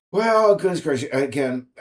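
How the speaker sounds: ordinary talk, nothing marked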